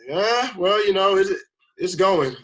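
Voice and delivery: high, loud, hedging reply; mock hesitant caller; imitated reluctance. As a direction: stall as if bad news is coming. Imitates caller withholding good news.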